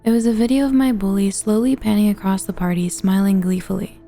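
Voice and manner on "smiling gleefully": usual speaking voice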